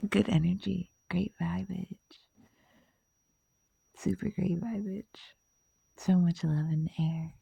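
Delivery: quiet, blissed-out whisper; hushed, happy murmur; soft whisper. Real, not acted. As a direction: breathe it out dreamily. Blissful recollection.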